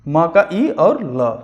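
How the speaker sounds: म का ई और ल spoken slowly; slow spelling. Shown as a demonstration. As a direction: sound out each part. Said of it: "teacher spells out मिल". (धीमे हिज्जे)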